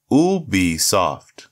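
Ubisoft said slowly, each syllable apart. Shown as a demonstration. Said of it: "break it down syllable by syllable".

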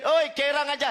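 high-pitched shout across the street. Retold or imitated. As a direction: yell the nickname from the car. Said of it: "lagi jerit".

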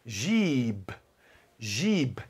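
"Jib" with a stretched i; long vowel. Shown as a demonstration. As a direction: hold the i in "Jib", low and soft. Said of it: "risquinho em cima do vogal, faz isso".

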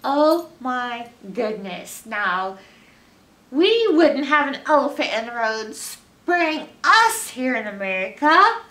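plain reading voice

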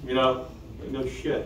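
normal talking voice

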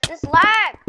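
frustrated shout. Real, not acted. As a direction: yell at the lag. Gamer raging at lag.

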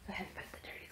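fast hushed whisper. Real, not acted. Vlogger putting clothes away at night, whispering. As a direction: whisper it quickly to yourself. Late night, others asleep.

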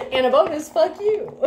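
high-pitched, giggling through the words; cracking up. Real, not acted. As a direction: toss it out while laughing. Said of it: "That cracks me up".

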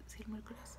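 very soft, whispered self-check; whispered aside. Real, not acted. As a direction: whisper it to yourself while remembering. Checking the day to herself.

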